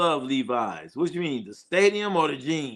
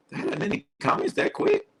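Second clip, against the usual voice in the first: squeaky disbelief. Pretend. Squeaky puzzled question.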